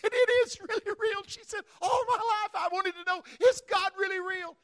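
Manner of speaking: high, loud, elated voice; amazed, overjoyed. Retold or imitated. Woman who just felt God, high and elated. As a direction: burst out in joyful wonder.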